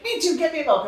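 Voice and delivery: loud, high retold order; flustered dad. Naked dad asking for milk, loud and flustered. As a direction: act out dad calling out a request.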